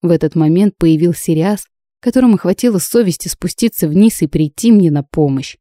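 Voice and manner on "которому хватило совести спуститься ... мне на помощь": plain reading voice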